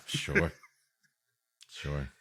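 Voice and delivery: normal talking voice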